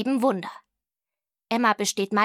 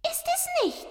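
tiny, very high cat interjection; high-pitched cartoon cat question; 3 dB quieter than usual. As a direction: squeak it as a contradicting cat. Cat chips in with a thought.

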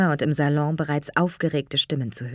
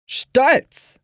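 high, louder, drawn-up single word; dignified; 9 semitones higher, 5 dB louder than usual. A narrator's reading. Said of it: "führte Clavell würdevoll aus". (würdevoll betont)

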